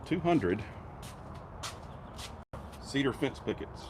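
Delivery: talking while bouncing over rough ground; on a bumpy road. Real, not acted. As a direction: voice jolted by a bumpy ride. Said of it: "it's gonna be a bumpy ride for a second".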